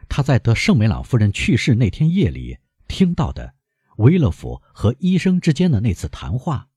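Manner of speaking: ordinary delivery, nothing marked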